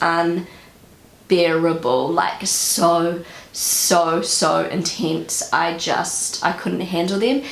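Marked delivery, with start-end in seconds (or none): none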